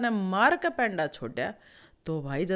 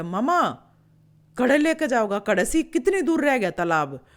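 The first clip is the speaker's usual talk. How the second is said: loud questioning call; 4 semitones higher, 6 dB louder than usual. Acted. केकड़ा उड़ते बगुले से पूछता है.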